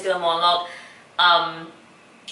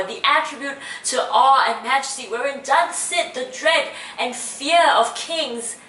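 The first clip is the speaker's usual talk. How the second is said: impassioned stage verse, higher pitch with stressed key words; raised, emphatic classical recitation; Shakespeare monologue; 4 semitones higher than usual. Acted. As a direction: deliver Portia's plea as measured, building oratory. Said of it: "I will be performing a monologue".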